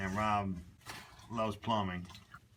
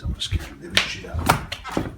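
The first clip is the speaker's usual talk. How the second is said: giggly prank whisper; 9 semitones lower, 4 dB louder than usual. Real, not acted. Sneaking up to scare friend.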